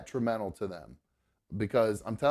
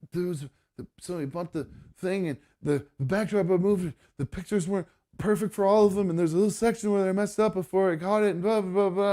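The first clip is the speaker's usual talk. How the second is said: higher, louder mock-upset rush of words; mimicking upset daughter; 8 semitones higher, 3 dB louder than usual. Dad imitating his upset daughter explaining the mistake, higher and louder. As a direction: imitate her panicked explanation, voice pitched up. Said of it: "And she goes".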